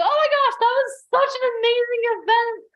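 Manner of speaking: high, loud gushing that calms toward the end; mock a starstruck attendee raving about the event; gushing fangirl.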